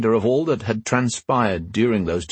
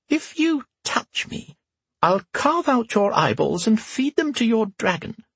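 put-on high creaky old empress, dry; old woman voice; 13 semitones higher than usual. A narrator's reading. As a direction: tart old woman's retort. Whisper's voice held.

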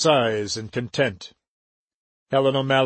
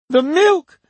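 much higher and louder shocked cry; 14 semitones higher, 6 dB louder than usual. A narrator's reading. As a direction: gasp it out in horror. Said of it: "Aunt Maggie cried".

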